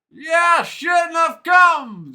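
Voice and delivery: put-on high, loud, slow drawl in a heavy Hadati accent. Scowling man warns visitors at his door, Hadati accent drawl. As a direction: drag the words out with a heavy Hadati accent, unwelcoming. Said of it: "drawled the man with a heavy Hadati accent".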